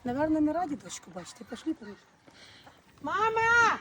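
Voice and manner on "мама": calling out. Real, not acted. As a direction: call out for mum from the gate. Calling for mum at the house.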